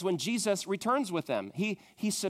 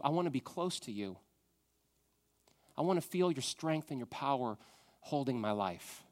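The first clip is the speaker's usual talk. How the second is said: soft, quiet, intimate words of love to God; hushed devoted prayer; tender prayer; 3 semitones lower, 5 dB quieter than usual. Acted. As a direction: pray it quietly like a whispered confession. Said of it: "throw up our hands and say".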